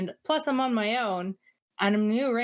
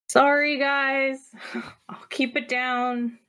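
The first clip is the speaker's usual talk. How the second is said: drawn-out, higher-pitched sorry to the neighbors; apologetic call; 5 semitones higher, slower than usual. Acted. Player answers neighbors woken at three thirty, apologetic call. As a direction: call out a sheepish, stretched apology.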